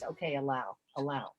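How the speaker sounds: ordinary talk, nothing marked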